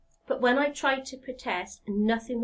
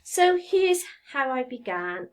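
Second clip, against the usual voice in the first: usual speaking voice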